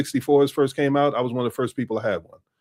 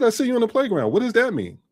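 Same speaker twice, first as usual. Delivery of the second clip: higher-pitched, openly voiced feigned puzzlement; mock innocence; 8 semitones higher than usual. Man denying he threatened anyone, innocently puzzled. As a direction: repeat it as if it were harmless, pitch up. Innocently replays his muttered warning aloud.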